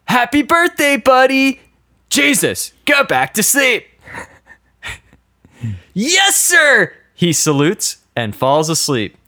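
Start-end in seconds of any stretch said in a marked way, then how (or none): 0.1-1.6 high, hammed-up birthday cheer; acted cheery shout. Guy to best friend he just woke, hammed-up cheer. Acting friend in read-out sketch. 2.1-3.9 high-pitched, hammed-up annoyance; acted exasperation. Drunk best friend woken in a car, acted exasperation. 5.9-6.9 high, eager acted obedience; mock salute. Guy obeying his sleepy friend, mock-military eagerness. Sketch character obeys and salutes.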